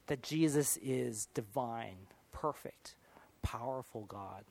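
hushed whisper of wonder; awed whisper. Real, not acted. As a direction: breathe the words out in a hush. Awe at Jesus' divinity.

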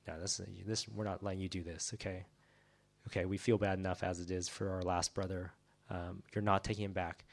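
low, soft refusal; subdued protest. Acted. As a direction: object quietly on a brother's behalf. Brothers' acted protest to servant.

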